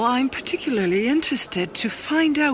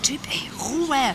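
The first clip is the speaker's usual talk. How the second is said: whisper; 3 dB quieter than usual. Acted. Shushing a noisy neighbour.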